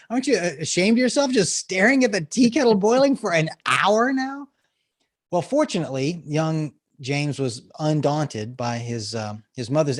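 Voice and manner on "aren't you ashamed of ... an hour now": high-pitched, hammed-up scolding; mock motherly bark. Mother scolding boy over the tea kettle, mock barking. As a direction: play the exasperated mom shaming her kid. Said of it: "she kind of barks at him".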